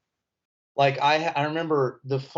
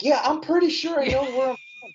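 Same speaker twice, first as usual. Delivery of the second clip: high mock-sarcastic reply, pitched way up; sarcastic retort; 13 semitones higher, 3 dB louder than usual. Retelling the reply to a doubting classmate, sarcastic and pitched up. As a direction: snip back with a sarcastic edge. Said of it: "I'm like".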